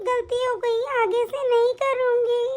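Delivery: put-on high, slow little girl's apology; a small girl saying sorry, slowly; childlike voice. Niece apologising to her aunt in a high, childlike voice.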